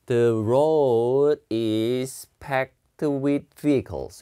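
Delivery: "the road is packed with vehicles" said very slowly, word by word; slow pronunciation demo. Shown as a demonstration. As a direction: spell out each word slowly for learners. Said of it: "발음이". (아주 천천히 또박또박 발음 시범)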